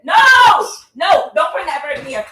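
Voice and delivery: high, loud alarmed no; real high-pitched shout of alarm; screaming. Real, not acted. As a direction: yell urgently to stop the cat. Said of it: "That's when I started screaming".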